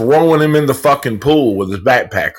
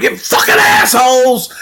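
much higher, heated mock rant at the bullies; retold angry rant; 9 semitones higher than usual. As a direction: spit it out as the furious bullied kid. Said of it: "He's like".

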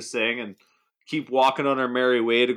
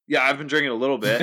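blurted confession; much faster than usual. Retold or imitated. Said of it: "And then Devin goes".

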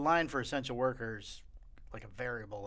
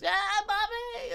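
much higher, slow drawn-out cheer; mock fan yell; much slower than usual. Imitating a nascar fan yelling at cars, drawn out. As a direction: stretch it out like a race fan.

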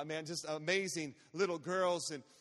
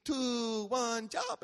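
put-on higher, drawn-out little-girl count; cute kid countdown voice; child's countdown; 3 semitones higher, much slower than usual. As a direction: imitate a small daughter counting down. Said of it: "she would count down for me".

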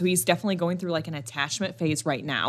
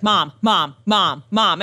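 louder insistent mom calls; kid calling mom; 5 dB louder, much slower than usual. Retold or imitated. Mom imitating her son calling her, louder. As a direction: call out for attention like a kid. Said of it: "he's like".

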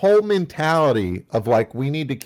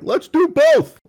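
mock developers; 5 dB louder than usual. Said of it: "these neurodivergent developers are like".

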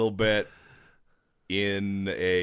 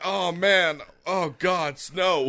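dismayed groan; 9 semitones higher, 4 dB louder, slower than usual. Retold or imitated. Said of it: "I'm like".

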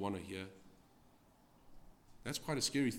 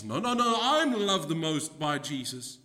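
outbidding disciple; 6 semitones higher, 8 dB louder than usual. Acted. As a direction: cut in and outdo the rival. Second disciple outbidding the first.

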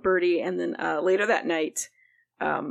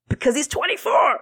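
loud, high exasperated burst; incredulous outburst; 8 semitones higher, 5 dB louder than usual. Real, not acted. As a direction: push it out in disbelief. Exasperated recap of TV plot.

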